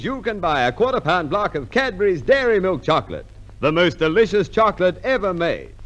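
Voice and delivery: normal talking voice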